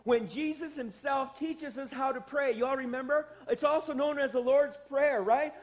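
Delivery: usual speaking voice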